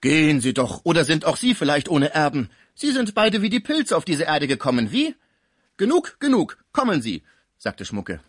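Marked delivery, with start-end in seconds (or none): 0.0-5.1 loud, higher, mocking chatter; needling concierge. Concierge needles both old bachelors about heirs, loud mocking questions. 5.8-7.2 high, loud, urgent command; exasperated interruption. Acted. German friend drags the concierge away, loud exasperated order.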